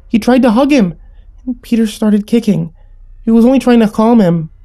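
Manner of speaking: put-on high, insistent child's voice; pleading. A narrator's reading. Little sister explains how the rabbit died, pleading. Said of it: "Tammy pleaded".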